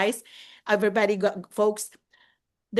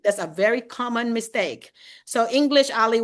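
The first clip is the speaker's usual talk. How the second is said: normal talking voice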